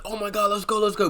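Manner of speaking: fleeing couple urging the driver, high panicked cry. Retold or imitated. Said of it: "They're like".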